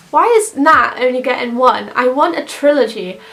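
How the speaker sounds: ordinary talk, nothing marked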